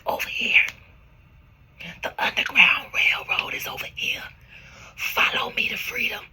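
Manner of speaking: urgent stage whisper to followers; acted secret whisper. Guide calling runaways to the Underground Railroad, whispered. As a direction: whisper it like a covert summons. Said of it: "telling them".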